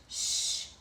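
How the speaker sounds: hushed hissing shh; sh sound. Shown as a demonstration. Teacher showing the sh sound to children. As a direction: hush out the sh. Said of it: "words that start with S H".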